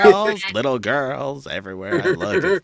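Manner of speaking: lightly voiced Miss Hannigan; a hint of tipsy Miss Hannigan. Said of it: "I go to Carol Burnett".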